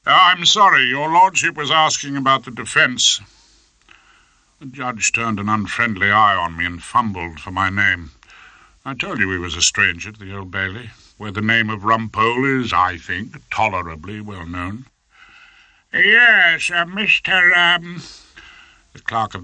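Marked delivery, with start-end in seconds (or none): 0.1-3.2 higher and louder, cutting in firmly; loud interruption. Acted. Interrupting the judge. 15.9-18.0 high, slow, lordly judge voice; a pompous high-court judge, much higher; fussy old judge. Acted.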